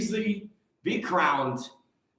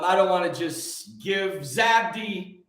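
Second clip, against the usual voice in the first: ordinary delivery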